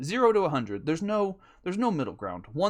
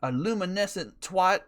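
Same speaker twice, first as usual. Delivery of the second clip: slow, louder mock yell, higher; hammed-up furious outburst at a driver who cut him off; retold road rage yell; 4 semitones higher, 5 dB louder, slower than usual. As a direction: bellow the nonsense insult at a driver. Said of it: "that's what I was like".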